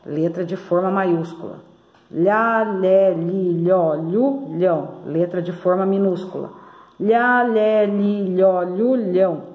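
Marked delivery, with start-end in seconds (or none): 2.1-4.9 lhá, lé, li, lhó, lhu, lhão drawn out in a connected run; syllable drill. Shown as a demonstration. Teacher leading pupils in repetition, drawn-out syllable recitation. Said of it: "vamos repetindo aqui". 7.0-9.4 lhá, lé, li, lhó, lhu, lhão drawn out in a connected run; syllable drill. Shown as a demonstration. Teacher leading pupils in repetition, drawn-out syllable recitation. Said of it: "vamos repetindo aqui".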